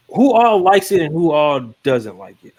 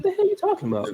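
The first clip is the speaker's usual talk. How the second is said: incredulous; 7 dB quieter than usual. Retold or imitated. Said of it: "I was like".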